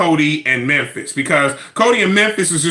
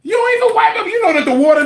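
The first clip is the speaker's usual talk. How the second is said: outraged disbelief; 3 dB louder, faster than usual. Real, not acted. Outraged rant.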